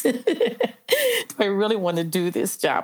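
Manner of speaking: laughing doubt. Retold or imitated. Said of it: "made me really wanna question".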